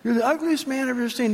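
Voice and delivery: high parrot voice; high-pitched parrot repeating its insult; taunting parrot. Acted. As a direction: pipe it up like a mimicking parrot. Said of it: "there goes the parrot".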